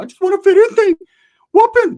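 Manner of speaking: very high, loud cartoon yell; mock an eager hothead in falsetto; squeaky shouted imitation; Ricky putting on an act. Mocking Ricky's eagerness to fit in.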